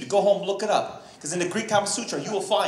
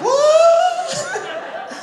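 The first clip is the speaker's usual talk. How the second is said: very high, loud, drawn-out "what"; shocked shriek; 9 dB louder than usual. Retold or imitated. Comic reacting to his buddy's claim, high mock-shocked squeal. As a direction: squeal it in exaggerated disbelief.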